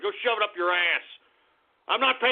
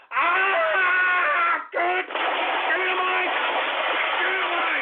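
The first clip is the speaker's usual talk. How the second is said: high, strained mock wail; hammed-up pained yelp; mock cry; 9 semitones higher, much slower than usual. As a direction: wail it away as if in pain. Yelling in mock distress.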